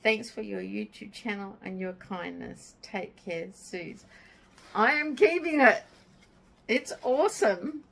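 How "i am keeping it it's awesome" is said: excited. Real, not acted. Delighted response to the card.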